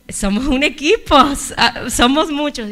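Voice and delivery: high, loud flustered objection; startled protest. Retold or imitated. Preacher protesting to God that others could go, high and flustered. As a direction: push back, caught off guard. Protesting God's call to act.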